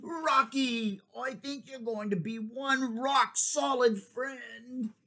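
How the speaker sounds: high, slow happy cry; delighted jelly. Acted. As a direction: cheer for a new friend. Jelly befriends a rock.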